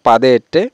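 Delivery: পাদে এট্টে without the linking n; faulty পাদে এট্টে, as some say it; common mispronunciation. Shown as a demonstration. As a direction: say পাদে এট্টে with a gap between the vowels. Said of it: "এরকম কেউ কেউ উচ্চারণ করে থাকে".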